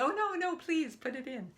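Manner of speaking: high, loud, hurried-sounding insistence; retold flustered, grateful pleading; eager deferring. Eagerly acting out her reply.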